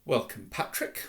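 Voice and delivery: restrained, formal welcome; stiff. A narrator's reading. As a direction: greet him with cold courtesy. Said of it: "said Nick stiffly".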